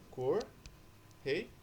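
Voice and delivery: "Cor-rei-o" in three separated syllables. Shown as a demonstration. Teacher showing pupils where to split, "Cor-rei-o" syllable by syllable. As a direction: pause after each part of "Cor-rei-o". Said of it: "tá falando desse jeito pra vocês entenderem".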